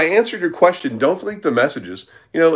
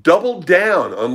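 normal talking voice

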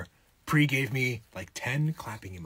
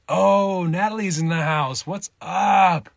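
excited greeting; 9 semitones higher, 12 dB louder than usual. Real, not acted. Greeting a viewer who arrived.

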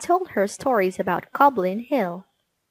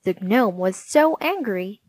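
ordinary delivery

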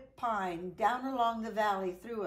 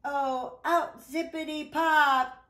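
excited pop; 5 semitones higher, 8 dB louder than usual. Acted. Mud rescue climax.

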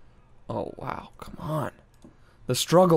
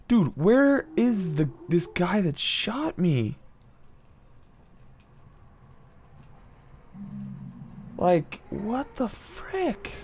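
high, slow, incredulous complaint; exasperated disbelief; 8 semitones higher, 4 dB quieter, slower than usual. Real, not acted. Gamer hunting the guy who shot him, incredulous. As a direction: stretch it out, pitched well up.

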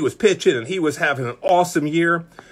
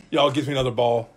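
normal talking voice

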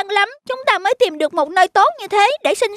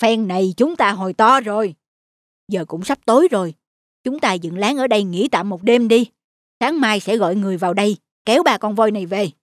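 gruff hunter; 9 semitones lower than usual. Acted. Hunter telling his partner to camp by the trapped elephants, low and satisfied. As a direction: lower voiced hunter, pleased with the catch.

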